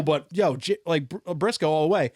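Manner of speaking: normal talking voice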